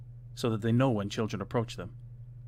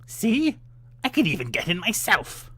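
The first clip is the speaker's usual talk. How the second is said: much higher, louder shrieking witch; loud high witch demonstrating; impatient witch; 11 semitones higher, 7 dB louder than usual. A narrator's reading. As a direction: show her how, exasperated. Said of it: "said she".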